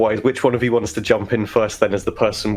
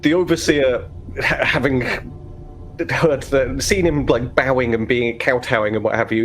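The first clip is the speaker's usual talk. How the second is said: normal talking voice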